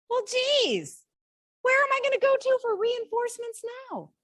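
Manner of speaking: mother at 3 a.m., exasperated and high. Retold or imitated. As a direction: throw up your hands, high. Retold moment of frustration.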